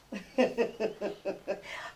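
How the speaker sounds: low, soft rippling laugh; quiet chuckle. Real, not acted. Host amused by a girl's answer about brothers, soft chuckle.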